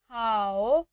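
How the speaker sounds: हाउ with a dipping third tone. Shown as a demonstration. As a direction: dip हाउ low and stretch it. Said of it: "इस पर भी third tone use हो रही है". (तीसरी tone में हाउ)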